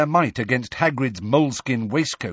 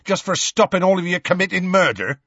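higher-pitched, indignant protest; testy; 9 semitones higher, 3 dB louder, faster than usual. A narrator's reading. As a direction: throw it back testily. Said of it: "said Hagrid testily".

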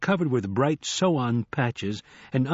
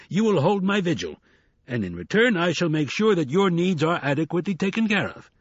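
higher-pitched, emphatic boss laying down terms; commanding hustler; 5 semitones higher than usual. Acted. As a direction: dictate the job like a done deal.

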